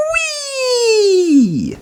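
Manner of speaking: high sliding squeal; excited whee. A narrator's reading. Firefighters sliding down the pole, high squeal. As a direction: whoop down the pole.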